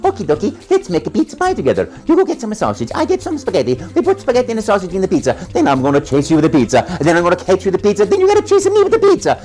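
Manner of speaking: high, fast Italian-accented Mario; squeaky excited Mario patter. Shown as a demonstration. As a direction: bounce through it like the cheerful plumber. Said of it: "I said in the voice that you hear today".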